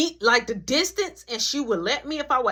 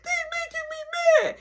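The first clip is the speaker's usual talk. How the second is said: put-on whiny kid.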